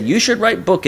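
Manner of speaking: put-on light, high-pitched Japanese accent. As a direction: imitate a Japanese mentor's accent. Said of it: "in his Japanese accent".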